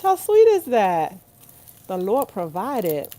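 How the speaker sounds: slow, fervent thanks; touched. Real, not acted. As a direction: savor the sweetness. Touched by a viewer.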